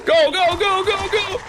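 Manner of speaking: very high, urgent repeated shouts; urgent yelling. Real, not acted. Gamer urging a move in game, urgent high yelling. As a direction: yell to urge it on.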